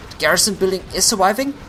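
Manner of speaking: fast, high excited call. Real, not acted. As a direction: rattle it off in excitement. Caster excited at a survival.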